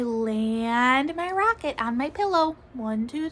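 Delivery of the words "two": usual speaking voice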